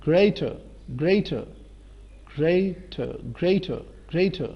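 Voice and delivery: greater ending in "tuh", no r; silent final r. Shown as a demonstration. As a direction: model greater repeatedly, falling, r dropped at the end. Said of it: "گوادر نہیں اب ہوگا".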